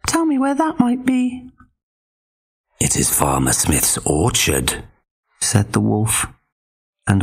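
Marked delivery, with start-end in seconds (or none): 0.1-1.5 very high pig voice; eager little pig. Acted. Pig voice in story. 2.8-4.8 low growly wolf, faking friendly. Acted. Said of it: "his voice was growly".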